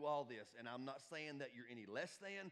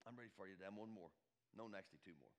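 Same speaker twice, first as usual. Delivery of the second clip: ordinary talk, nothing marked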